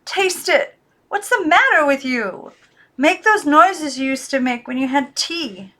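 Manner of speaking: high, loud desperate order; frantic demand. Acted. Girl orders the doll, frantic. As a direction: demand it, near panic.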